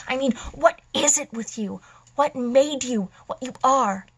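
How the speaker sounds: exasperated outburst. Acted. Villain demanding what made his foe this way, exasperated outburst. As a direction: burst out in acted exasperation.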